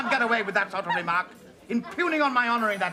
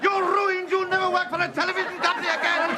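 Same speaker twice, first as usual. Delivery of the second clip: outraged yelling; 8 semitones higher than usual. Acted. Husband shouting down the guest and the restaurant, outraged yelling. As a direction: rant like a pompous man whose honour is wounded.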